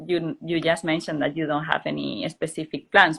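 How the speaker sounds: ordinary talk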